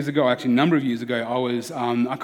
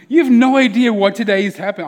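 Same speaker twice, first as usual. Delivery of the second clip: raised, louder indignant retort; 7 semitones higher, 8 dB louder than usual. Retold or imitated. Imagined comeback to the cashier, indignant and louder. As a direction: fire it back at the stranger, affronted.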